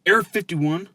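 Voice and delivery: usual speaking voice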